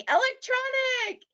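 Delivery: high, mock-shouted "Electronic"; mock holler. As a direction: call it out like an eager crowd.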